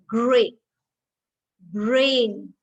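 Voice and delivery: "grape, brain" loud, R clearly sounded; R pronounced. Shown as a demonstration. Teacher gives examples of the rule, R pronounced. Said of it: "So you will pronounce the R".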